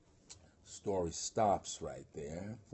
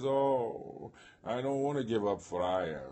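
lightly acted protesting giant, raised and louder; a drawn-out, reluctant "oh", then protest; a hint of a balky giant in a retelling; 3 semitones higher, 5 dB louder, slower than usual.

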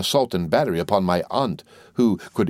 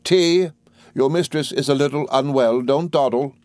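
calling out; 7 semitones higher than usual. A narrator's reading. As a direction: order the servant briskly. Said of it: "cried Miss Betsy".

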